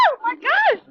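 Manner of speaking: high, loud alarmed gasp of words; startled as the drone lurches; alarmed exclamation. Real, not acted. Drone buffeted by wind.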